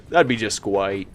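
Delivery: lisping through a laugh. Pretend. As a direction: keep the put-on lisp going. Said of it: "I'm intentionally saying that with a little bit of a lisp".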